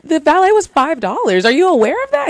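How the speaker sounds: high, pointed challenge; indignant high-voiced retort; retold indignation. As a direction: pitch it up, lecturing the cheapskate. Retold indignant reply to date.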